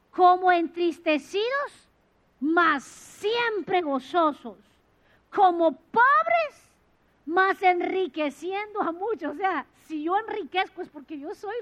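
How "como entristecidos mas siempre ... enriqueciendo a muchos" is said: slow, loud declaration of scripture; slow loud proclamation, stretching mas; solemn scripture recital. Acted. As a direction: proclaim each phrase slowly.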